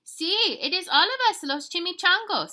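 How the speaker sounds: high, loud little voice with Spanish flavour; tiny excited chihuahua. Acted. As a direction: announce the gang proudly. Tiny dog announces the gang.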